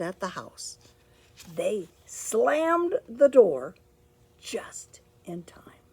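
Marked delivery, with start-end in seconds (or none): 4.4-5.8 whisper. A narrator's reading. Narration drops to whisper.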